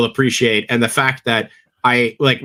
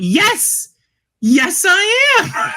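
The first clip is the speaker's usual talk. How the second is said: proud admission; much slower than usual. Retold or imitated. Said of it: "And I went".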